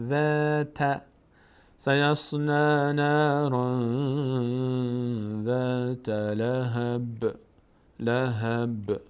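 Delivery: slow, drawn-out tajwid recitation; Quran recitation. Shown as a demonstration. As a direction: chant each word slowly. Recites verse.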